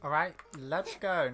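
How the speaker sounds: ordinary talk, nothing marked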